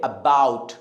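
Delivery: "about" slow, low, weight on "bout"; short "uh", then press on "bout" in "about"; stress on second syllable. Shown as a demonstration. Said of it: "इस word को हम क्या बोलेंगे". ("about" में "bought" पर ज़ोर)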